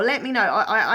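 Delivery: ordinary talk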